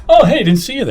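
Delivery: high, overplayed casual greeting; fake casual surprise. To [name] in the lobby, mock casual surprise. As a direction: act like you just happened to bump into him. I do the whole, I'm going in.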